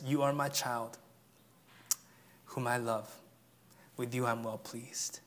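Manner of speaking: soft, hushed voice of God; quiet reassuring blessing; tender. Acted. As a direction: say it gently like a loving father. Preacher voices God's tender words.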